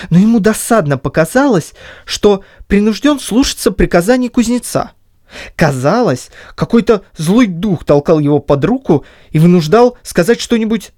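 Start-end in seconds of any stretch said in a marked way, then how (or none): none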